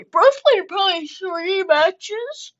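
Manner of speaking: slow, loud taunt about the opponent; loud mocking. Real, not acted. Gamer taunting opponent, slow loud mock. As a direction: drag it out to mock him.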